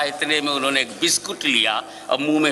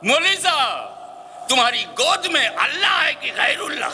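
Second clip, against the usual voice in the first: mocking challenge; 10 semitones higher than usual. Onlooker challenging the cleric.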